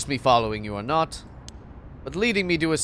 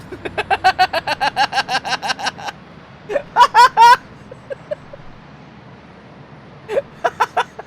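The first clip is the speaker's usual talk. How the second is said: high laugh; 5 dB louder than usual. Real, not acted. Laughing at the game.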